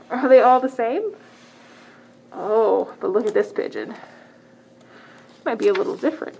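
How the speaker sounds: ordinary delivery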